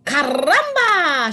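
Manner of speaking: high, loud squawked Caramba; a dramatic hen cries out; chicken crying out. A narrator's reading. Hen bursts out at the nest, high and loud. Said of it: "she cried".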